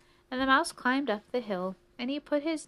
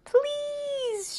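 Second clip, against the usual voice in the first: very high, drawn-out loud plea; shouting; 5 dB louder than usual. A narrator's reading. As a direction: shout a long, desperate plea. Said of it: "shouted the mouse".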